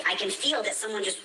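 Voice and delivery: usual speaking voice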